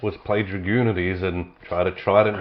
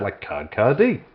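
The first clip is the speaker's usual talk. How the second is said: ordinary talk, nothing marked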